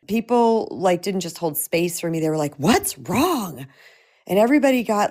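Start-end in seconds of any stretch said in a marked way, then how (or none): 2.5-3.7 exaggerated, swooping alarmed question; mock intense concern. People reacting to a single mom crying, mock intense concern. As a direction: imitate people fussing with big pitch swoops. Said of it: "all intense about it".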